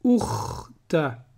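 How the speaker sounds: ukhta spoken slowly; slow blending. Shown as a demonstration. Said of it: "ça fait".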